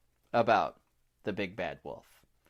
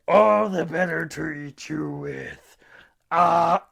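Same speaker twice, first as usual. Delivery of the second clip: louder, higher, drawn-out wolf voice; menacing wolf; 4 semitones higher, 6 dB louder, slower than usual. Acted. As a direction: draw it out loudly, ending on a wide-mouthed "ah". Wolf reveals his appetite, opens mouth.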